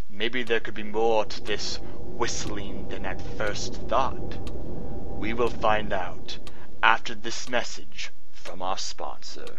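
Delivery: low, slow wrap-up narration; low, measured narrator voice; radio narrator. Acted. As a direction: lead the listeners calmly into the break. Narrator wraps up before the break.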